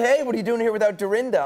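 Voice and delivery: high, bright-eyed fan quiz; eager fan question. Retold or imitated. Fans asking Anderson about Dorinda, high and eager. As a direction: ask like a fan who knows the show. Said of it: "they're like".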